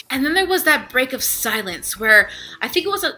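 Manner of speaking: ordinary delivery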